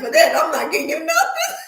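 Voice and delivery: imitating the sister laughing as she refuses; laughing through it. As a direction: laugh the words out, squealing high. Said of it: "And she cracked up laughing".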